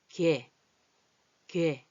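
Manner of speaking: 걔 clipped into one syllable; short 걔 demo. Shown as a demonstration. Teacher modelling contracted 걔. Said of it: "얘를 짧게 발음하고".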